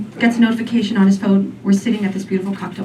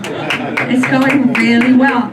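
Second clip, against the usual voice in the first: slow, dragged-out insistence; slow ironic repeat; 2 semitones higher, much slower than usual. Shown as a demonstration. Comic repeating the claim slowly to the audience. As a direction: drag it out, unconvinced.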